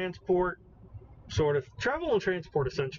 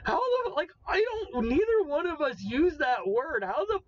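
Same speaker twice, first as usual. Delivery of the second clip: baffled disbelief; 8 semitones higher than usual. Real, not acted. Baffled at correct word.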